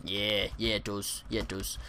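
put-on a lightly done British accent, softly. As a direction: answer smugly in a mild British accent. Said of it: "In the British accent and everything".

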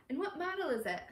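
high, keen professor's inquiry; eager question. A narrator's reading.

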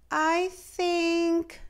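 put-on timid young girl starting to speak; little girl. A narrator's reading. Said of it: "said Sophia".

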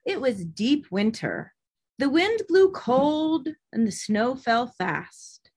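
usual speaking voice

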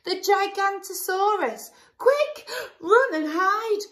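high, loud panicked shout; high loud cry of fake alarm. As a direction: raise the alarm to friends. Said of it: "Bonehead cried".

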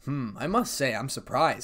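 usual speaking voice

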